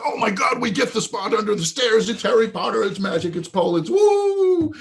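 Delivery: high, loud gushing fans, building to a whoop; going crazy with excitement. Retold or imitated. Guests given the Harry Potter spot, mock-ecstatic. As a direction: imitate thrilled guests squealing with joy. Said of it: "they go absolutely crazy".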